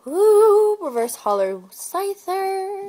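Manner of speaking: high, thrilled reveal, drawn out; a real rising ooh into a savored card name; excited. Real, not acted. Pack opener pulling a favorite Pokemon, real excitement.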